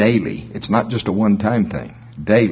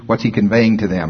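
normal talking voice

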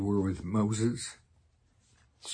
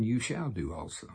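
ordinary talk, nothing marked

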